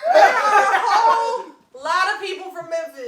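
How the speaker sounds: high, loud laughing protest, slowed; laughing too hard to argue. Real, not acted. Defending Memphis people while laughing hard.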